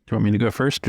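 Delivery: ordinary talk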